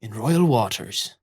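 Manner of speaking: a lightly voiced gruff pirate mother; pirate captain voice. Pirate mother warning her crew to keep the cover, in a lightly gruff voice. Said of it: "tells them with rum-heavy breath".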